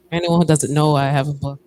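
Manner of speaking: ordinary talk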